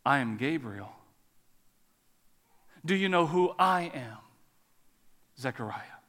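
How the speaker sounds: measured, restrained authority; Gabriel. Acted. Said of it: "the angel responds".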